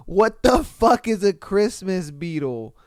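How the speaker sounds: ordinary delivery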